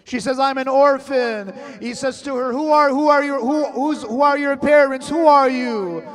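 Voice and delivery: loud, rising mourning preacher; full-force majlis lament; impassioned lament. Acted. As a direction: pour grief into the story, building. Building majlis lament, ever louder.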